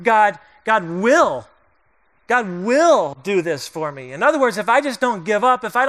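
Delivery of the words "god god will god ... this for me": slow, leaning hard on will in God will; insistent God will. Retold or imitated. Pastor mimicking stubborn faith, slow and insistent. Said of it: "another approach to faith that says this".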